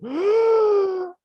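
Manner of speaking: long, high shocked gasp; startled gasp. Acted. Onlookers seeing the lame man walk, startled gasp. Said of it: "깜짝 놀랐던 거죠".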